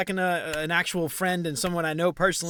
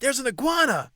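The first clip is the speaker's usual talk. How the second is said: high, loud, amazed announcement; excited imitation; 10 semitones higher, 6 dB louder than usual. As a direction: imitate a man bursting with news. Acts out man's excited announcement.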